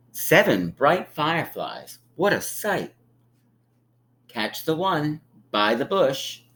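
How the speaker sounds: sing-song picture-book read; gentle storybook narration; reading to children. A narrator's reading. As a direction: read it like storytime for little ones.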